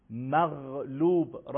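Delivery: teacher's very slow model of مغلوب; slow demo. Shown as a demonstration. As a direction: stretch مغلوب and rub the غ.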